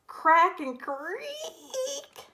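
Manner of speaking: high, very slow, drawn out creak; creaking ice. A narrator's reading. Narrator voicing the ice giving way, slow creak. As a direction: stretch it like cracking ice. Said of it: "crack went the ice".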